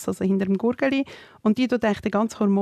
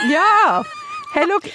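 loud, much higher burst to grab attention; excited shout; 13 semitones higher, 5 dB louder, slower than usual. Real, not acted. Friend cutting in loudly to continue her story, excited. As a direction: jump in loudly, eager.

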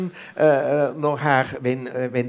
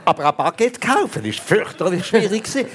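higher, amused through laughter; laughing; 7 semitones higher than usual. Real, not acted. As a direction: laugh at how hard buying bread was. Laughing at own Paris struggle.

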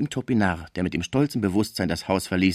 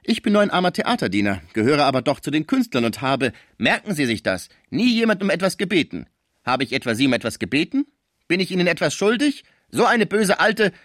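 loud, much higher offended speech; proud indignation; 8 semitones higher, 5 dB louder than usual. Acted. Topinard scolding the old woman, high and loud. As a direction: stand on your dignity, loudly.

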